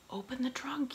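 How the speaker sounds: captain ordering his men to open the trunk, shouted. A narrator's reading. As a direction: bark it across the deck. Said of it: "he shouted".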